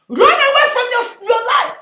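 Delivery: loud, high urgent cry; shouted warning. Real, not acted. Preacher cries out a warning.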